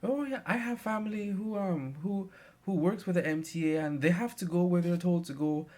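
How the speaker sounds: put-on high, chatty old lady voice; old lady defending driver. Passenger sticking up for the driver, high chatty old lady. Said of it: "one of the ladies, she started to pick up for the driver".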